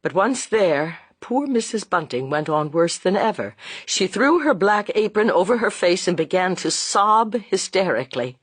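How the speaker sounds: ordinary delivery, nothing marked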